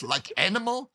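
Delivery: put-on Hungarian accent. Father scolding his son, imitated Hungarian accent. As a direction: echo dad's indignant Hungarian-accented question. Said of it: "in his Hungarian accent".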